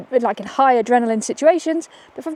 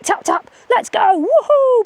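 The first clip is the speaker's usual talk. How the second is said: high and loud, whooping; high, loud cheer to the dog; energetic dog cue; 11 semitones higher, 4 dB louder, slower than usual. Shown as a demonstration. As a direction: pump up the dog with a woohoo. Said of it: "So I'm like".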